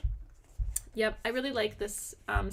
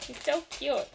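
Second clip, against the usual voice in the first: normal talking voice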